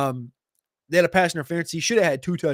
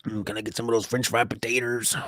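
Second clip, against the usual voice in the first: Sling Blade impression; 4 semitones lower, 4 dB quieter than usual. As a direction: do the Sling Blade guy asking for potatoes. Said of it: "the movie reference, Sling Blade".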